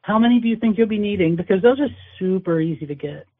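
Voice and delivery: ordinary talk, nothing marked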